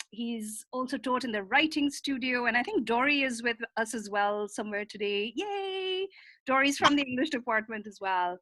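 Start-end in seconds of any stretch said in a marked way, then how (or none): 5.3-6.1 little cheer. Real, not acted. Cheering a colleague's presence.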